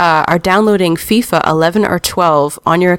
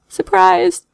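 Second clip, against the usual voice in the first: sarcastic; 13 semitones higher than usual. Real, not acted. Sarcastic news aside.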